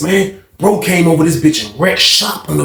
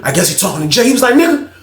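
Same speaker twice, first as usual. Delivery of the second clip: ordinary talk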